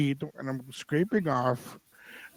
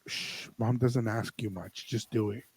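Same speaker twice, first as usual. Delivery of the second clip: hushing; 4 semitones lower, 9 dB quieter, slower than usual. Retold or imitated. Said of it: "he said".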